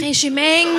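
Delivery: attempted southern French Marseille accent; mock Marseille accent. Shown as a demonstration. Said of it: "mais l'accent marseillais".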